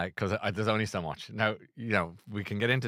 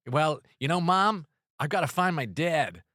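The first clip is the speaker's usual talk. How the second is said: a lightly voiced lost kid, higher and louder; mock Tom Holland; 6 semitones higher, 5 dB louder than usual. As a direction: mimic a whiny modern teen hero. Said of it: "now you sound like you're lost in a shopping centre".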